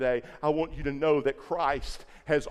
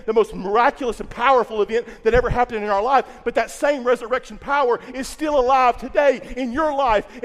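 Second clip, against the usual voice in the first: louder, driving sermon delivery; full-force preaching; 5 semitones higher, 7 dB louder than usual. Acted. Pastor rousing the Easter congregation, loud and driving. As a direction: preach it with building conviction.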